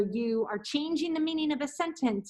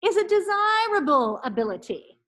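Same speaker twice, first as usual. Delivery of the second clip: careful articulation; 6 semitones higher, 4 dB louder than usual. Shown as a demonstration. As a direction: enunciate every syllable clearly. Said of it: "So repeat after me".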